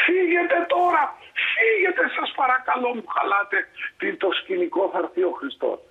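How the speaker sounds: angry shout. Retold or imitated. Lord yells at the mother and children, angry shout. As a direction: drive them away, yelling. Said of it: "μια μάνα με δύο παιδάκια".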